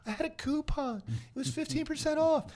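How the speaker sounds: very high squeaky mock voice; imitating wife. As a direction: squeak it out defensively. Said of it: "You're like".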